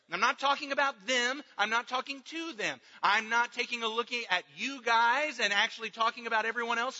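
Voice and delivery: loud, driving sermon delivery; preaching at full force. Acted. Pastor hammering the point to his church, full force preaching. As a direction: press it home to the congregation.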